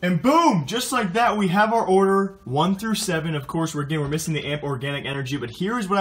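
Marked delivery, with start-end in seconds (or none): 0.0-3.4 excited. Real, not acted. Triumphant reveal of lineup.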